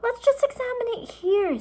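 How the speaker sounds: very high, teasing line; girl teasing her brother; cheeky retort. A narrator's reading. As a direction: toss his words back. Annie mocking Jack's words.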